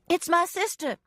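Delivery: high urgent shout to rescuers. A narrator's reading. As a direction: call out to the rescue squad. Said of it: "I shouted".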